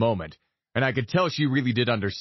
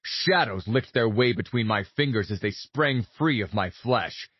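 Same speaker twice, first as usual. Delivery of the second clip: plain reading voice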